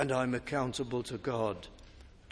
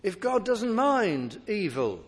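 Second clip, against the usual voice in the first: raised, driving preacher's voice; preaching; 6 semitones higher, 5 dB louder than usual. Acted. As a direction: preach it out to the congregation. Preaching at full force.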